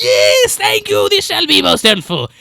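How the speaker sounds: shrieking high, loud creature thanks; elated lizard man. Acted. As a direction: gush loudly like an overjoyed lizard creature. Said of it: "and he says".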